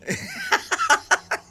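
bursting into a high laugh; quick squealing laugh. Real, not acted. Guest laughs at own remark.

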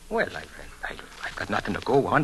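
low, faltering whisper; halting whisper hinting at a murder; hushed whisper. Acted. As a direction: lean in and whisper, stumbling. Danny hesitates before murder claim.

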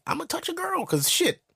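put-on high, eager voice of a young boy; teen boy thinking. Schoolboy plots about the girls in class. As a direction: scheme it like a cocky kid. Boy's thoughts in middle school.

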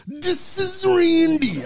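high, slow drawn-out Macho growl; Macho Man voice. Retold or imitated. Macho Man answering the prank call, drawn out. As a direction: drag it out like Randy Savage. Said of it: "He goes".

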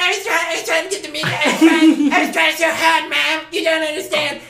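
high, fast whiny cartoon-kid voice; South Park kid. Boy pleading with his mom, high whiny cartoon kid. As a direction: whine like a little South Park character. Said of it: "sounds like a little South Park character".

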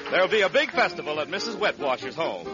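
ordinary talk, nothing marked

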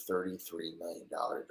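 normal talking voice

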